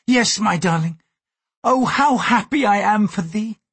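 impulsive. A narrator's reading. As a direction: blurt out your joy to a lover. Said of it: "she had said impulsively".

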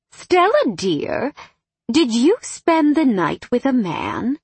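high, swooping motherly voice; astonished mother. A narrator's reading. Mother interrogates daughter after silence.

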